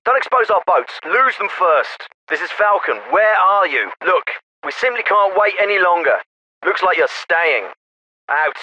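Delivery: military officer over a field radio; crisp orders through a narrow radio channel; radio transmission. Acted.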